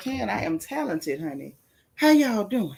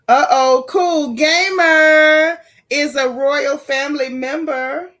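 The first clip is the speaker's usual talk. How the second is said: loud, high, drawn-out fanfare; hyped announcement; 6 semitones higher, 10 dB louder, slower than usual. Real, not acted. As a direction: belt it out like an announcer, stretching the name. Hyped announcement of new member.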